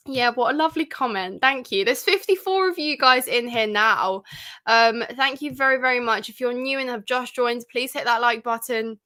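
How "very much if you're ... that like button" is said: ordinary talk, nothing marked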